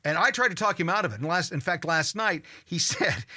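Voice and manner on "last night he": usual speaking voice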